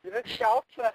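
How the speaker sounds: mock Liverpool Scouse accent, pitched high; teasing imitation of a Scouser; playful high Scouse impression. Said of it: "you've got this, like, thick Scouse accent".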